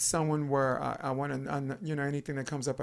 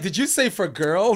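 louder, higher mock offended question; mock indignant; 5 semitones higher, 8 dB louder than usual. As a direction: play offended at the idea. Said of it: "It's like".

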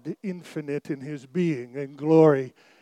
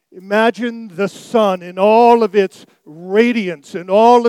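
higher, louder, rising sermon delivery; full-force preaching; 4 semitones higher, 11 dB louder than usual. Acted. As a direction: preach it out to the congregation, building. Preacher builds to climax.

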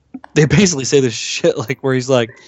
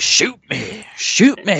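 second demand leaps much higher; building dare; 6 semitones higher, slower than usual. Retold or imitated. Imitating preacher right in the agent's face, escalating dare. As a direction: goad him, jumping up in pitch on the repeat.

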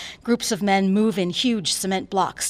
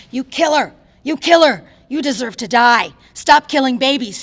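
furious high shout, much louder and higher; screaming; 5 semitones higher, 12 dB louder than usual. Acted. Protester screaming in the doctor's face. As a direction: scream it at her as an accusation. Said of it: "screaming at me".

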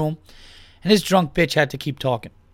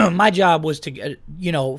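ordinary delivery

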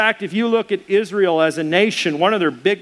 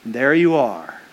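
lower, warm focus on the other person; welcoming greeting; 4 semitones lower, slower than usual. Shown as a demonstration. Pastor showing the right greeting on entering a room, lower and welcoming. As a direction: greet them as if they are the one who matters.

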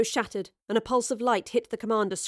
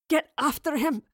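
strained; 6 semitones higher than usual. A narrator's reading. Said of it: "Beric said in a strained voice".

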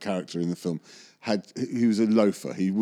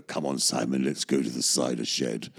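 imitating Oliver, pitched low; low, coaxing imitation of Oliver Reed; Oliver's low voice; 8 semitones lower than usual. Oliver luring Simon to the cider shed, low coaxing voice. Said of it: "And he kind of go".

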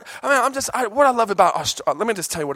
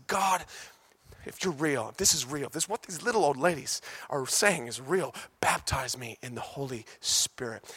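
hushed prayer; 7 dB quieter than usual. Retold or imitated. Moody's desperate midnight prayer.

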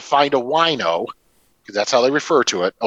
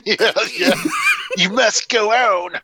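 laughing; 7 semitones higher than usual. Retold or imitated. Mock solemn encouragement.

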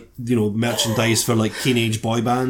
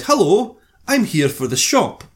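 fake confidence; 7 semitones higher, slower than usual. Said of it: "or fake confidence in this case".

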